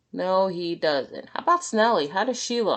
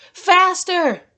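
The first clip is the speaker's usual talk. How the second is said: high, loud barked command; shouted; 12 semitones higher, 9 dB louder than usual. Acted. Coach SpongeBob yelling at exhausted Gary, loud shout. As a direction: drive the snail harder. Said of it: "shouted his coach".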